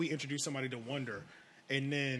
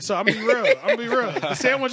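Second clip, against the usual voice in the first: laughing through the words; speaking while still laughing; 7 semitones higher, 8 dB louder than usual. Real, not acted. Laughing after his own story.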